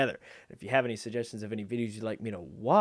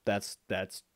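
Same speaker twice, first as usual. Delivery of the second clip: usual speaking voice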